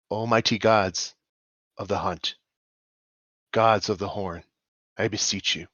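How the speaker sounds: beseech with reverence, long pauses between phrases; in-character prayer of an elvish hunter; solemn prayer. Acted. Kneels and prays in character.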